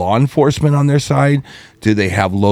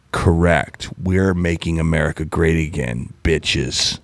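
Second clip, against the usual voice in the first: low, steady, a bit softer; lightly voiced confident engineers; deep voice; 7 semitones lower, 5 dB quieter, slower than usual. Host voicing a space lab backing America, low and steady. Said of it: "Jet Propulsion Laboratory saying".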